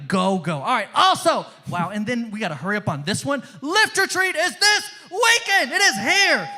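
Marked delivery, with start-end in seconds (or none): 3.6-6.5 high, loud, drawn-out hype shout; excited announcement. Real, not acted. Host hypes the weekend retreat to the youth group, excited announcement. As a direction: announce it big, pitched way up.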